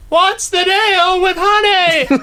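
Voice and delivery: very high, slow laughing impression; laughing through a squeaky imitation; Seinfeld impression. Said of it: "that is not Seinfeld".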